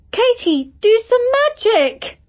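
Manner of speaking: put-on girl begs her friend, screaming; screamed plea. A narrator's reading. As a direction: shriek for help in terror. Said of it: "screamed Isis".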